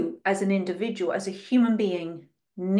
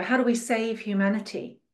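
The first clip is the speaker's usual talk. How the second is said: ordinary talk, nothing marked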